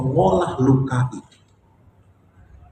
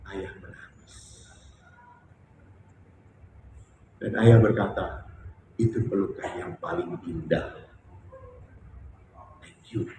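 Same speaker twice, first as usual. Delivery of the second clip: lirih terharu; 6 semitones lower, 8 dB quieter than usual. Real, not acted. Mengenang pelukan ayah.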